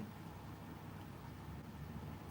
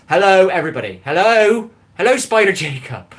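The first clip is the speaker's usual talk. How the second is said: loud exaggerated greeting; 6 semitones higher, 10 dB louder than usual. Real, not acted. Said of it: "saying hello to the same people over and over".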